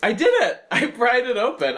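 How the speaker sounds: thrilled burst of triumph; excited. Real, not acted. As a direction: shout it out, delighted. Triumph after prying lock open.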